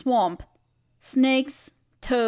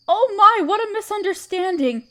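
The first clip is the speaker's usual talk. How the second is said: higher and louder, a delighted little gasp of surprise; amused surprise; 6 semitones higher, 4 dB louder than usual. Acted. Spider woman, surprised her guest feared being eaten, delighted gasp. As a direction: catch yourself off guard, charmed.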